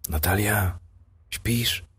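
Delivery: soft, hushed call to a sleeping wife; hushed whisper. Acted. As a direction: barely breathe her name. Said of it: "po cichu wyszeptał".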